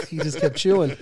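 laughing through the words; can't stop giggling. Real, not acted. Laughing at own story.

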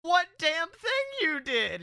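loud high incredulous rant; disbelieving, half-shouting at her; exasperated. Retold or imitated. Retelling his exasperated reply.

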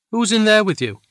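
high, loud shout through the wall; alarmed shout over the storm. A narrator's reading. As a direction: yell it at the bedroom door. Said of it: "I shouted".

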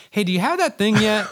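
a client chasing late work, nagging and impatient. Retold or imitated. Client emailing a late agency, high mock nagging voice. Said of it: "where Steve's like".

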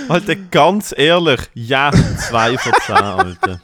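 slow, laughing repeat of the verdict; laughing replay. Real, not acted. Friend replays the two-out-of-ten verdict, laughing. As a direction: savour the brutal line through laughter. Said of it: "Oh mein Gott".

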